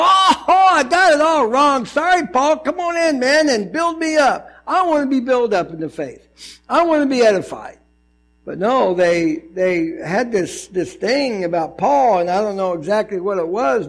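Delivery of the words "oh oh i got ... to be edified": much higher, louder, eager apology; hammed-up contrite believer begging to be built up; repentant Corinthians. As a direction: gush it out like a sorry convert. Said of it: "You would think the Corinthians would go".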